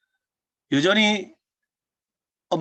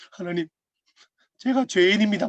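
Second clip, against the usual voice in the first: rising, imitated tearful plea, ending louder; mock crying prayer; 5 semitones higher, 3 dB louder than usual. Friend weeping at retreat, imitated tearful confession. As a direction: mimic a friend sobbing to God, half-serious. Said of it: "울면서 하는 말이".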